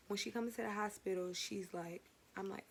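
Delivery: ordinary talk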